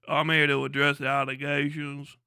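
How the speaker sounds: put-on southern voice, halting; southern accent. Southerner explaining himself about allegations, mock southern accent. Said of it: "one of them southern accents".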